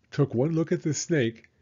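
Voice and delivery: usual speaking voice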